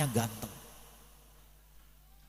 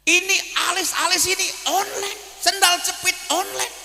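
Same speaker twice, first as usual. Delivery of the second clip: high, hammed-up whiny voice, a bit louder; mocking whine; 13 semitones higher, 4 dB louder than usual. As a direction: sneer at every online purchase. Mocking a lazy child's online shopping. (rengekan mengejek yang dilebih-lebihkan)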